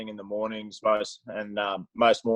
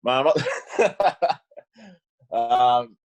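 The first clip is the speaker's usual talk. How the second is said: breaking into laughter; 2 semitones higher, 5 dB louder, much slower than usual. Real, not acted. Cracks up mid-answer.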